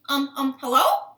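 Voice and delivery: loud, tentative hello rising at the end; frog calling out. Acted. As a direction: call out to a puzzling stranger. Frogs call to the stranger pig.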